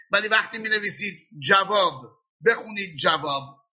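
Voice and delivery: ordinary talk, nothing marked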